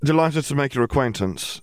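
mildly distracted greeting; courteous but only half attending; distracted politeness. A narrator's reading. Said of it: "sounded more distracted than delighted".

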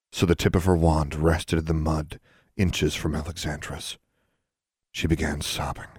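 ordinary delivery

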